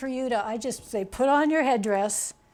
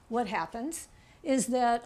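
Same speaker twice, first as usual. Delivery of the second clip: normal talking voice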